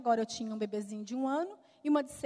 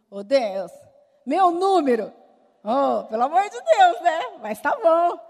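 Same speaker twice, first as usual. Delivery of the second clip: exasperated disbelief; 2 semitones higher, 11 dB louder than usual. Retold or imitated. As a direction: throw your hands up in comic disbelief. Her reaction to husband's compliment. (incrédula e exasperada, alto e agudo)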